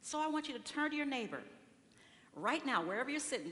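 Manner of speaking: ordinary delivery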